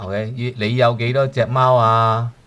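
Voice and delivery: ordinary talk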